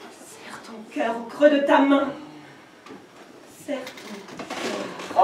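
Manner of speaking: doux et lent, presque soufflé; murmure lent. Acted. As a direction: murmure lent, comme une berceuse. Murmure intime, poème. (murmure doux et lent)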